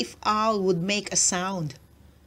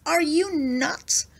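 scolding squirrel; 7 semitones higher than usual. A narrator's reading. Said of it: "Squirrel scolded".

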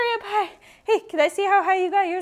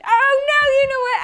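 high, loud oh-no; playful alarm; 6 semitones higher, 7 dB louder than usual. Real, not acted. Teacher realizes she forgot to say go, playful alarm. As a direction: cry out at your own slip.

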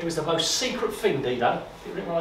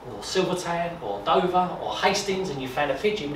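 ordinary talk

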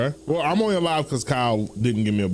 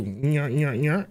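taunting nyah; much slower than usual. Pretend. Taunting noise.

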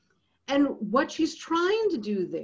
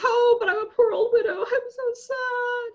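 much higher and louder, hammed-up crying lament; mock wailing widow; 13 semitones higher, 5 dB louder than usual. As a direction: wail it like a poor weeping widow, mocking. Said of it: "before she says".

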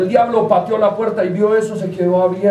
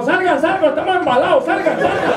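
high-pitched, alarmed calling; panicked urging; 8 semitones higher than usual. Acted. Factory owner ordering everyone out, panicked urging.

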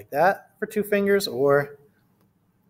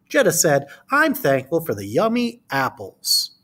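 put-on reading to children. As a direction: read it to a class of small kids. Reads picture book aloud.

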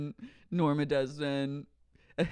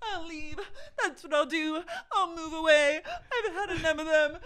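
high, wailing hammed-up crying while laughing; mock sob; 11 semitones higher than usual. Imagined star quitting in a tearful huff, mock sobbing. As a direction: wail it through fake tears. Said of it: "and he goes".

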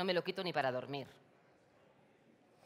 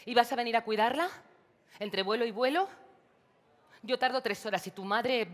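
raised, faster, accusing questions; angry reproach; 4 semitones higher, 7 dB louder than usual. Acted. María scolds Ana for never being around, raised angry voice. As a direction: throw it at her sister, rising and louder. Sisters quarrel over grandma's care.

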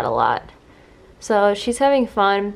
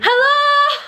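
squeaky high hello; high mock greeting; 14 semitones higher, 4 dB louder than usual. Real, not acted. Owner chirping a high joking hello. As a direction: pop it up very high.

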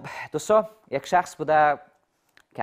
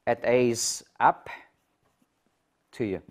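slow teaching model; much slower than usual. Shown as a demonstration. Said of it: "slow model of phrasal verb".

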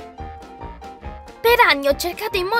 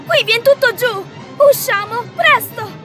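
urgent alarm; 5 semitones higher than usual. Acted. Building collapses, urging escape.

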